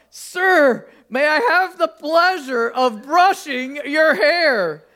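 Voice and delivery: loud, high, slow and deliberate, blurting it out; blurted out loud. Retold or imitated. Woman blurts her offer to the old man across the terminal, loud and high. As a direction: force the words out in one big nervous burst. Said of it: "took a deep breath and blurted out".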